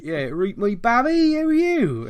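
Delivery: broad West Country, rising high and loud; playful exaggerated Bristol twang; thick Bristolian accent. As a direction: ham up a Bristolian numpty. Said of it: "how to sound like an absolute Bristolian numpty".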